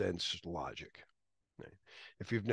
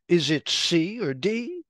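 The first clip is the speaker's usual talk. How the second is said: mock Kirk; 7 semitones higher, 9 dB louder, slower than usual. Kirk asking Spock.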